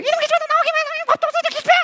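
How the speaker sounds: hammed-up furious mom; screeching mother. Mother yelling through door. (문에 대고 소리치는 엄마)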